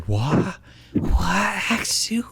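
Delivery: breathy disbelieving whisper. Shown as a demonstration. Iris staring at Haxorus in disbelief, whispering. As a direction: whisper it in shock. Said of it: "How do you whisper".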